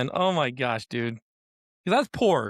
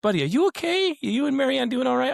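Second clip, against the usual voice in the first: mock concern; 9 semitones higher than usual. Mock concern about poverty.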